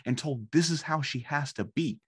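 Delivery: sudden realization. Retold or imitated. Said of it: "I was like".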